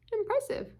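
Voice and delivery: high, impressed squeak; a lightly voiced frog, awed. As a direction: frog admiring the otter's languages. Frog remarks.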